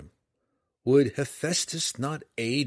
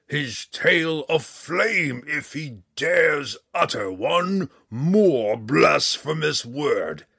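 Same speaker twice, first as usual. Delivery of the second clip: slow, loud, looming dragon voice rising to a roar; a vast red dragon threatening the little drow; menacing dragon decree; 4 semitones higher, 5 dB louder, much slower than usual. A narrator's reading.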